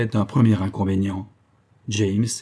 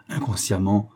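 plain reading voice

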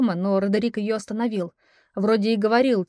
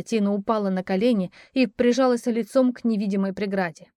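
plain reading voice

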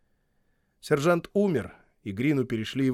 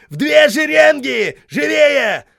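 high, loud bellow; shouting drill sergeant; 13 dB louder, slower than usual. A narrator's reading. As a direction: bellow at the ranks. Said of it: "заорал Хейксвилл". (вопящий сержант)

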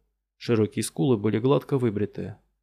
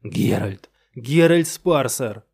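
loud, drawn-out cry; desperate scream; 5 dB louder, much slower than usual. Retold or imitated. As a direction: scream the name in despair. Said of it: "истошный крик Персибала".

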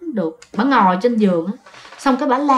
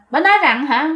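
plain reading voice

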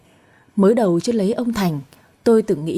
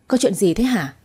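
ordinary delivery

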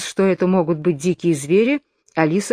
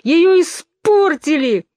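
high, slow, louder wail; wailing yell; 11 semitones higher, 5 dB louder, slower than usual. A narrator's reading. Twin stamping his feet, wailing in despair. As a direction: howl it in despair. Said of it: "завопил двойнюшечка".

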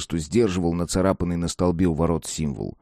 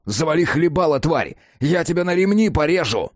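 raised, high-pitched threat; angry roar; 7 semitones higher than usual. A narrator's reading. Enraged man threatens the demon to her face, angry roar. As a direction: build the fury up to a high yell. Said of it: "проревел я ей прямо в лицо".

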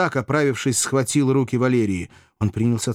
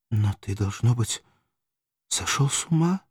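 frightened question; 2 semitones higher than usual. Acted. Valeria alarmed at Spartacus's strange words, frightened question. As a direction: ask it startled, voice jumping up. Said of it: "испуганно спросила Валерия".

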